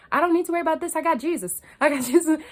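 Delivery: high, loud, mocking her old self; mock carefree believer. Mocking her past self to viewers, high and carefree. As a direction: parody her naive faith, up high. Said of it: "it was just like".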